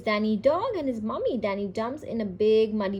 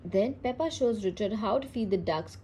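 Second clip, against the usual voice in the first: ordinary delivery, nothing marked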